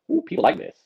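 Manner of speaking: high, airy "ooh" of discovery; pleased realisation. Retold or imitated. Magician retelling family liking his trick, pleased realisation. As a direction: act out a delighted little discovery.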